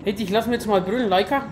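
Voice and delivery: usual speaking voice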